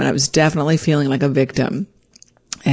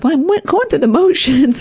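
sheepish; 10 semitones higher than usual. Retold or imitated. Answering her guru sheepishly.

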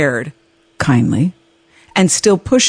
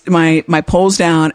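ordinary talk, nothing marked

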